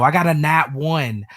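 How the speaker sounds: ordinary delivery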